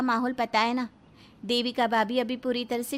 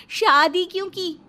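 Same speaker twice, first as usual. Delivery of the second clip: higher, louder scornful cry; bitter outburst; 6 semitones higher, 6 dB louder than usual. Acted. Wife scorning her spineless husband, loud bitter outburst. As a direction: spit out the regret.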